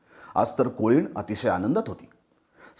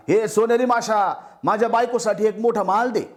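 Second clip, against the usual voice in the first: fisherman calling out; 8 semitones higher than usual. Acted. Fisherman calls the golden fish.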